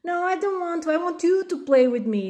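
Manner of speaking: put-on high, sulky kid voice; refusing child. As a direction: whine back like a clingy child. Child refusing, demanding play.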